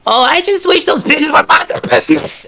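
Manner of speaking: high, loud, furious hag snarl; angry hag voice. Acted. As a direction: spit it out in icy rage. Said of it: "as she says".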